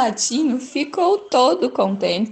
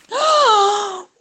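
sudden high gasp; a quick startled intake of breath; sharp gasp; 10 semitones higher, 3 dB louder than usual. Acted. Narrator gasping before the Gruffalo speaks, sudden and high.

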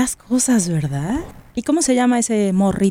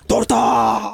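far-off street seller's shout; vendor's call. Retold or imitated. Storyteller playing a street vendor calling out his cakes, shouting.

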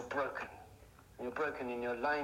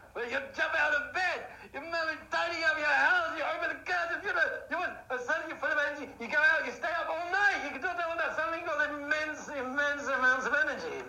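very high, loud rush of energy; explosive excitement; 12 semitones higher, 7 dB louder than usual. Acted. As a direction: shout it as if suddenly bursting with life. Acting out sudden burst of energy.

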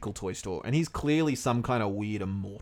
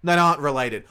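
louder, quick burst of realization; surprised blurt; 3 semitones higher, 8 dB louder than usual. Real, not acted. Co-host reads the search result aloud, surprised and loud. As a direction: blurt it out like you just found the answer.

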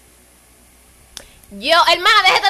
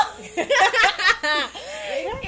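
long real high laugh; cracking up mid-story; 7 semitones higher, 4 dB quieter than usual. Real, not acted.

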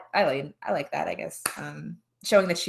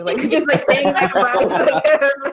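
laughing; 8 semitones higher, 8 dB louder than usual. Real, not acted. Laughing retelling scary taxi ride.

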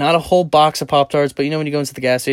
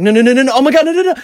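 loud, high frantic cry; panicked, high-pitched pleading at full volume; re-enacted panic; 14 semitones higher, 10 dB louder, much faster than usual. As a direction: yelp it out in mounting panic. Re-enacts lasagna falling out of the pan.

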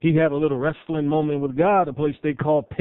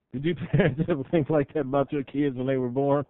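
ordinary talk, nothing marked

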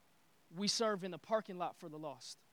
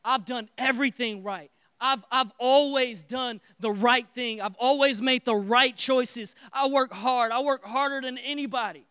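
furious; 4 semitones higher, 11 dB louder than usual. Acted. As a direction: hammer home every word of complaint. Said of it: "And he's furious".

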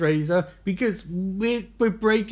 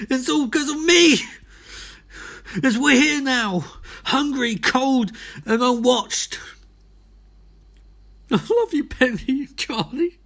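louder and higher, a mock on-air breakdown; despairing confession; 7 semitones higher, 3 dB louder than usual. Stranded host confessing to listeners, mock despairing. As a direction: wail out the guilt.

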